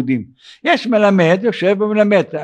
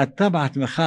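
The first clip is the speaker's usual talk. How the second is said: normal talking voice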